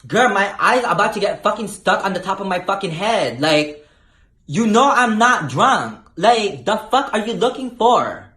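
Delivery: high, loud exasperated sass. Retold or imitated. She mocks the cop's eye test, loud and high. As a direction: roll your eyes through it. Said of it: "I'm like".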